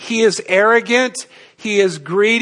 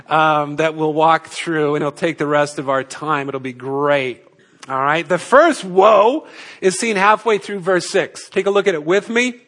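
normal talking voice

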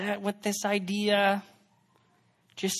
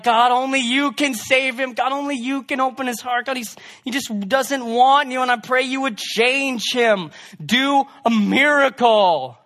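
higher and louder, pleading with God; fervent loud prayer; 7 semitones higher, 6 dB louder than usual. Retold or imitated. Girl praying hard for her unsaved brother, loud and pleading. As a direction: pray out loud with urgent desperation for her brother. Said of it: "you should have heard her prayers".

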